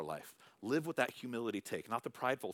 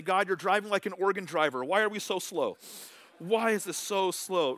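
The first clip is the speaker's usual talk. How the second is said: impatient complaining; 8 semitones higher, 7 dB louder than usual. Pretend. Complaining to God about speed.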